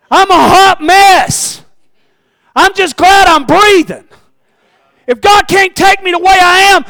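high, loud, pleading disbelief; sick man yelling back at friends about clothes; exasperated sick man. Acted. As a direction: cry out slowly in disbelief. Paralytic protests.

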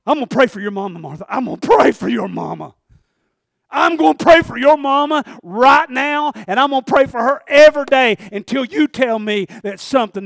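loud, firm promise to pray; emphatic loud vow; bold declaration. Retold or imitated. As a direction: declare it boldly to the boss. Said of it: "And I said".

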